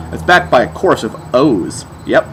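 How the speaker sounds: normal talking voice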